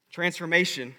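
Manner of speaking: ordinary delivery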